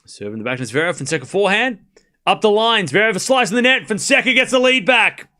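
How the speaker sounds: rising excitement, live on the point; tennis play-by-play. Real, not acted. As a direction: build from restrained rally calling to an excited point call.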